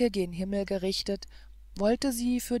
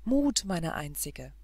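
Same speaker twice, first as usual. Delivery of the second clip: ordinary delivery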